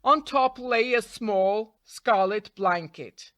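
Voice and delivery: ordinary delivery, nothing marked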